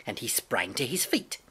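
plain reading voice

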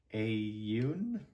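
ordinary delivery

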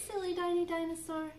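ordinary delivery, nothing marked

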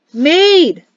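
loud, high indignant cry; exclaimed. Acted. Drunk Perrin hears ale compared to mead, loud exclamation. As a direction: blurt the word out in shock. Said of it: "Perrin exclaimed".

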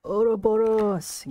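excited. Real, not acted. Card name announced with flair.

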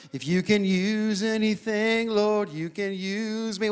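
slow, soft, earnest prayer. Retold or imitated. Pastor recalling his humble prayer, slow and soft. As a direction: pray it quietly, slowly. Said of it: "God reminded me".

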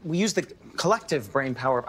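talking while chewing gum. Real, not acted. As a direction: chew gum as you talk. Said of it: "that gum chewing is insane".

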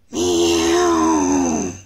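deep held meow; a clumsy low meow; low failed meow. Acted.

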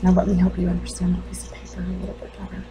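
hushed, near-whispered put-down; bereaved mother, coldly controlled. Acted. Mother setting the doctor straight about the paper, quietly controlled. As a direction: drop the voice and explain with restraint.

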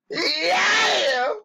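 very high screaming yeah. Acted. Imitating a soul singer's shouted yeah, very high. [name] style shout.

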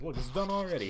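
normal talking voice

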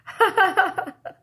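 bright high burst of laughter; real giggle. Real, not acted. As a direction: laugh out at the funny picture. Laughing at the book.

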